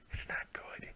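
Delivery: hushed breathy aside; whispering. Real, not acted. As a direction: whisper it to yourself. Repeats line, second time whispered.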